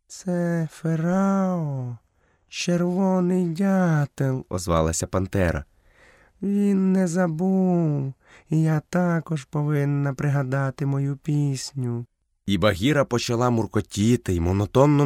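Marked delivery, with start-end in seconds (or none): 0.1-4.5 high, slow, drawn-out panther voice; panther character. A narrator's reading. Panther tells Mowgli who is calling, high and drawn-out. 6.4-12.1 high, slow panther voice; a lingering, high-pitched feline drawl; panther character. A narrator's reading. Panther remembers she must practise her song, high and drawn-out.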